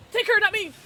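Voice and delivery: loud, high yell; panicked plea. Real, not acted. Player begging the killer to chase a teammate, panicked yell. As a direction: shout to push danger onto someone else.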